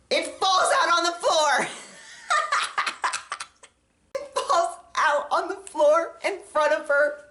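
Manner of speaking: half-laughing disbelief as the shameful bit lands; giggling through the retelling of the accident; laughing while telling. Real, not acted. Laughing at own story.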